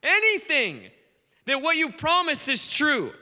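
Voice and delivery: high, drawn-out plea; pleading prayer. Retold or imitated. Believers looking out to their nations, pleading prayer. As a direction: plead upward, stretching the key words. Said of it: "and go".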